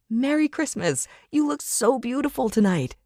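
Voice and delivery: high-pitched, gushing party guest; gushing young woman. Acted. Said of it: "she came in for a hug, saying".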